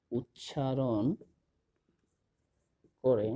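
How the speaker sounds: very slow, with long gaps between words; drawn-out words, spaced for note-taking; slow dictation. Real, not acted. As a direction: dictate word by word while writing. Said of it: "আমি লিখে দিচ্ছি".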